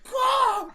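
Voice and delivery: loud, high drawn-out caw; bad deer cry. Answering friends who ask the deer's sound, bad deer cry. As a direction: squawk out a terrible deer imitation. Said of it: "What sound did the deer make?".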